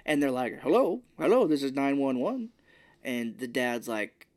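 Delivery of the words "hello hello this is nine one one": a brisk dispatcher picking up the line; operator voice. Retold or imitated. Emergency operator answers call.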